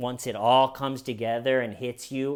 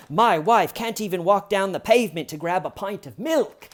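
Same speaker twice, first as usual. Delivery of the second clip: higher, louder hammed-up fake accent, lifting at the end; [name]'s ridiculous accent; 5 semitones higher, 5 dB louder than usual. Suspect pleads for his wife in interviews, ridiculous put-on accent. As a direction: complain in a mocking, overdone accent. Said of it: "ridiculous accent, and one of the things he says".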